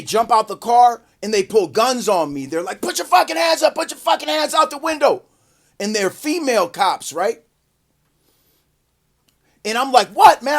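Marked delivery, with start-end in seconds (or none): 2.8-5.2 high-pitched barked cop orders; urgent, high mock shout; imitated police yelling. Cops with guns yelling orders. 10.1-10.6 high, loud indignant outburst; loud, high-pitched disbelief; retold startled protest. Startled reply to armed cops.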